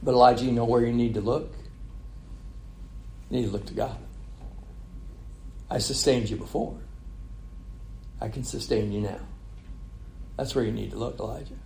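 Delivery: soft, gentle voice of God calling Elijah; small still voice. Acted. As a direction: speak it softly, like a still small voice. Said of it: "And God's saying".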